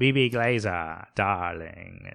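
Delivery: theatrical Mid-Atlantic drawl, dropped r's; Mid-Atlantic accent. Acted. Introducing Frasier's agent, Mid-Atlantic accent. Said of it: "she speaks with a Mid-Atlantic accent".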